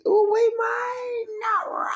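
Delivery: drawn-out high yell; pained shout. Retold or imitated. Retelling car over leg, slow high pained yell. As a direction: cry out at the pain.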